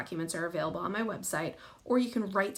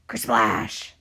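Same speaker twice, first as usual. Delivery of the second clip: low, loud crashing sound word; deep kersplash; 10 semitones lower, 7 dB louder than usual. A narrator's reading. Reader voicing the monster's arm hitting water, deep loud kersplash. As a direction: crash it down like a giant wave.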